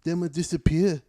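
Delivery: put-on Irish brogue, cocky taunt; Conor McGregor. Fighter teasing his opponent, imitated Irish accent. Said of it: "straight Conor McGregor".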